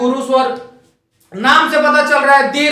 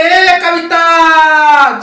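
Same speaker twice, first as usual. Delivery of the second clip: very slow, higher, louder long-drawn call; 4 semitones higher, 6 dB louder, much slower than usual. Retold or imitated. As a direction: stretch the name to reach someone far off. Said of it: "बोलते हैं". (नाम खींचकर ज़ोर से पुकारना)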